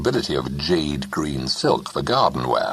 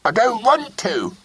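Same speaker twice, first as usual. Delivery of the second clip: put-on stamp out the refusal again like a cross boy; loud stubborn child; repeated loudly. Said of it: "he repeated loudly".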